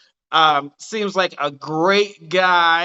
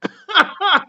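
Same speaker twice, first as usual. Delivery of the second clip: high laugh; 12 semitones higher, 4 dB louder than usual. Real, not acted. Host laughs at question.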